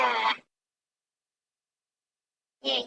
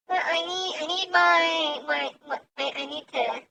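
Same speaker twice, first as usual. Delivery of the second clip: normal talking voice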